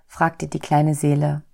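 ordinary delivery, nothing marked

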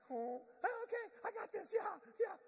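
high, loud, re-energized; rallying. Pretend. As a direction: suddenly get your second wind. Lead goose rallies.

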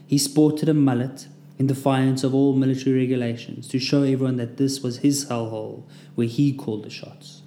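ordinary delivery, nothing marked

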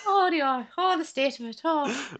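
high-pitched mock Irish lilt; exaggerated fake Irish accent; crap Irish accent. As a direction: put on a silly stage-Irish voice. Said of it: "put on our crap Irish accents".

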